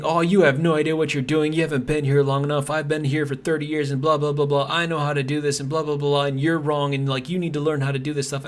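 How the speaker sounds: mocking coworker. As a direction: parody his condescending email like a smug veteran. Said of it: "he writes me an email and basically just rips me apart".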